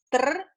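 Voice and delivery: teacher isolating the Tr cluster for her students; tr sound. Shown as a demonstration. A clear teaching demo of Tr. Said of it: "consonante, consonante, vocal".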